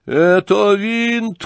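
high, loud, stretched-out accusation; furious shout. A narrator's reading. General accusing the young man, furious shout. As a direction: shout it slowly at high pitch. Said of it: "кричал генерал".

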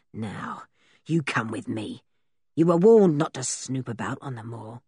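low, rough-mannered man's threat; menacing captor. A narrator's reading. Captor's voice in the story.

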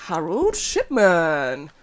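drawn-out showman's reveal of a name; jovial, big presenter flourish. Real, not acted. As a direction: announce it far too cheerily, like jazz hands. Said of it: "without it sounding so jovial".

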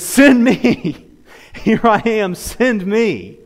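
high, loud cry of readiness; eager volunteering. Acted. Isaiah eagerly answering God's call, high and loud. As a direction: call out boldly, answering the summons.

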